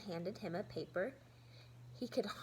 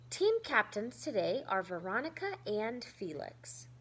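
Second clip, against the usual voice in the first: ordinary delivery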